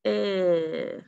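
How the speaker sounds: "Eh" as one long held sss; isolated sound. Shown as a demonstration. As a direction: hold the lone sound written "Eh" as a hiss. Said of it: "What's the third sound?".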